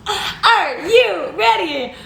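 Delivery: slow, high, drawn-out tease; playful suspense; teasing build-up. Pretend. Teasing the first taste.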